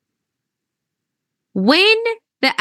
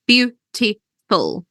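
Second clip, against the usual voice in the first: slow, chopped into three beats; syllable by syllable; slower than usual. Shown as a demonstration. Teacher showing three syllables, beautiful split slowly into beats. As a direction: say "beautiful" as three separate pieces with pauses between. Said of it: "it has three syllables".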